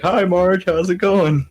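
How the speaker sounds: high, loud mock-cheery greeting; forced cheer. Hostage at gunpoint greeting Marge for the camera, forced cheer. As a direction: put on a big smile for the camera. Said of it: "Just smile to the camera".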